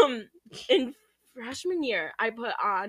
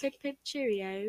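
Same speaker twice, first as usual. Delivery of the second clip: softer, badly faked British catchphrase; mock British accent; 6 dB quieter than usual. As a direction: lightly put on a silly British accent. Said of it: "British accent".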